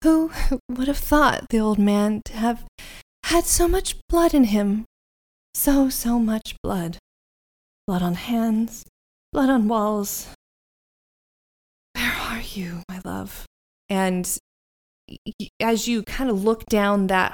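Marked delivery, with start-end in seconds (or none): 0.0-10.3 lightly voiced eerie woman; light airy voice. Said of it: "light, airy voice saying". 11.9-13.4 eerie whisper; airy whisper. Acted. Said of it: "light, airy voice saying".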